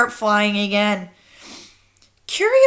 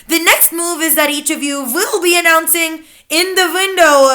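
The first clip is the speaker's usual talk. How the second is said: louder, higher witch leader announcing; lightly accented witch leader; cried out; 7 semitones higher, 6 dB louder than usual. A narrator's reading. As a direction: lay out the plan as the Grand High Witch. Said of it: "and cried out".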